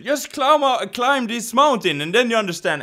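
high, loud urging; loud, high mock coaxing; calling down. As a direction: holler down from a mountaintop. Mountain-top friend voiced urging him up.

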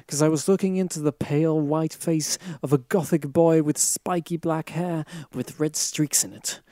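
ordinary delivery

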